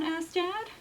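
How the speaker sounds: plain reading voice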